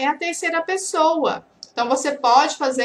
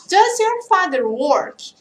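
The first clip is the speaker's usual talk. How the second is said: slow model question; 4 semitones higher, slower than usual. Shown as a demonstration. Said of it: "Você pode colocar o seu pai".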